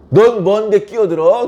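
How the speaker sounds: mock-indignant challenge to an intruder; angry retort. Quarreller turns on the meddling passer-by, angry retort. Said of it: "간섭하는 나를 공격합니다".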